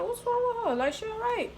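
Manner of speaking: very high-pitched incredulous question; exaggerated high disbelief, retold; mock bewilderment. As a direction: ask it in a squeaky-high puzzled voice. Retold bewildered reaction to staring.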